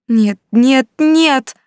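loud, high cry climbing to the last word; unrestrained yell. A narrator's reading. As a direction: yell it out, no longer holding back. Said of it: "уже не сдерживаясь, заорала Рита".

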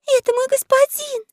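very high, tiny frightened voice; high-pitched startled exclamation; gasped alarm. A narrator's reading. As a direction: squeak it in awe of the master. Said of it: "ахнула Заури".

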